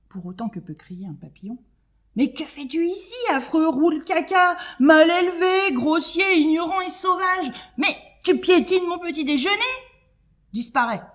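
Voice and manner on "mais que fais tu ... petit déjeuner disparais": high, loud outraged insults; crying out. Acted. Butterfly yelling at the scarab on her breakfast, high and furious. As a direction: hurl the insults at him. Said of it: "Cléopâtre se lève et crie".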